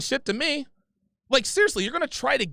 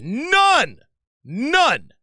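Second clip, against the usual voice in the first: two loud, higher barks of none; shouted refusal; 4 semitones higher, 7 dB louder than usual. Real, not acted. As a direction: slam the word down twice.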